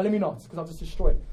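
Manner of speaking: normal talking voice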